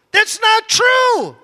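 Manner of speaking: high, slow, deliberate insistence; slow emphatic denial. Real, not acted. Preacher correcting the congregation, slow emphatic insistence. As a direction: stretch out the denial. Repeats, slower and louder.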